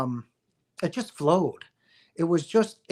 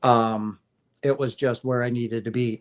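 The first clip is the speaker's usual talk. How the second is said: ordinary delivery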